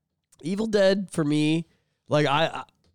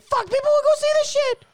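very high, loud outburst; exasperated outburst; 5 dB louder than usual. Real, not acted. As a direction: plead with them in exasperation. Exasperated plea to Capcom.

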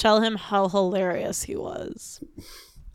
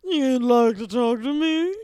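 Vincent Price; slower than usual. Acted. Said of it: "Vincent Price is maybe the better one".

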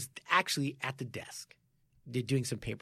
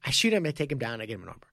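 normal talking voice

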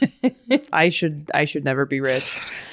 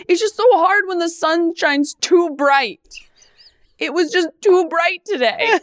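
mock whining; 10 semitones higher than usual. Ironic privileged whining.

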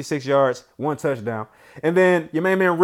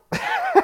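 high burst of laughter. Real, not acted. Laughs after hyping the team.

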